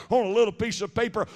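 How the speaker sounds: ordinary talk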